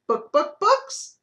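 very high loud clucking book; playful hen cluck. Acted. Hen sounds in barnyard.

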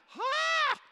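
shrieked high startled "Ha!"; a jolt of alarm; startled yelp. Retold or imitated. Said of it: "I'm like".

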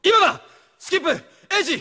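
sudden urgent shout, high and loud. Acted. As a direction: yell the signal to run. Signal to escape, shouting.

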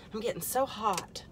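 ordinary talk, nothing marked

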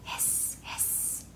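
long unvoiced hiss. Shown as a demonstration. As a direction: hiss like a snake. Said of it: "They go hiss".

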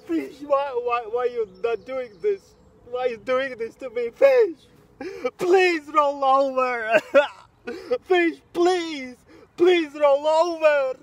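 high, drawn-out wailing plea; mock tearful pleading. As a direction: beg the fish through hammed-up sobs. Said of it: "I'm crying so much".

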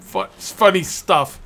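drawn-out, slow funny stuff; slow mock savoring of funny stuff; slow stretched line. Shown as a demonstration. Repeating the phrase.

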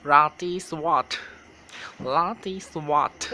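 "ราตรีสวัสดิ์" said as "roti suwot" in a Western tourist accent; mock foreign accent. Shown as a demonstration. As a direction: say "ราตรีสวัสดิ์" like a farang mangling the Thai, with flattened tones. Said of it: "ออกเสียงพร้อมกันนะคะ". (ออกเสียง "ราตรีสวัสดิ์" สำเนียงฝรั่งพูดไทยแบบล้อเลียน)

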